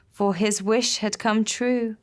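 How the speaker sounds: ordinary delivery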